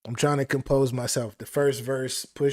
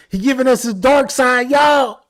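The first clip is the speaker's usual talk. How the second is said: higher, louder excited yell; hype shout; 8 semitones higher, 10 dB louder than usual. Real, not acted. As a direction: shout it to the chat. Hyped shout at audience.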